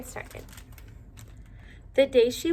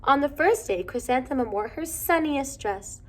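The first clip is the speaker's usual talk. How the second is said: ordinary delivery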